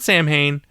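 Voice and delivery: Samhain said as sam-HAIN; common mispronunciation of Samhain. Shown as a demonstration. Host quoting what everyone calls it, Samhain as sam-HAIN. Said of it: "That's not how it's pronounced".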